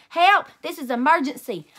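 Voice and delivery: loud high cry for help; calling nine one one in a panic; yelled. A narrator's reading. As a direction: yell into the phone. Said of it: "I yelled".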